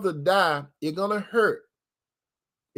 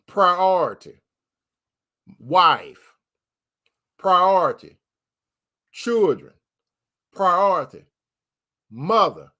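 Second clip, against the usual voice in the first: preaching cadence. Real, not acted. Chanted priority list.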